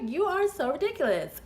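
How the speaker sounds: high-pitched exasperated retort; incredulous. Retold or imitated. Woman answering a pushy flirt, high-pitched incredulity. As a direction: pitch it way up in mock disbelief. Retelling her exasperated reply.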